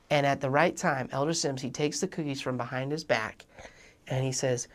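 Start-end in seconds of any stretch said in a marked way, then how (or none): none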